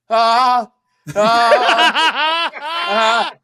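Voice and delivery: loud, high, frozen "ahs"; helpless drawn-out noises, mind gone blank; stunned stammering "ah". Retold or imitated. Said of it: "This is how I sounded".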